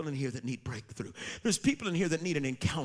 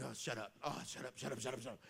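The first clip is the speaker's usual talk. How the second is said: groggy annoyance; 4 semitones lower, 13 dB quieter, faster than usual. Retold or imitated. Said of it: "and it's like".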